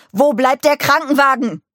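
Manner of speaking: high, loud desperate roar; roaring at him. A narrator's reading. Nick roaring at Mike about the ambulance. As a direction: bellow the question at Mike in despair. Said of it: "brüllte er Mike an".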